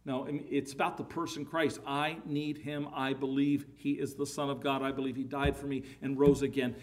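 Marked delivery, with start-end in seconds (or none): none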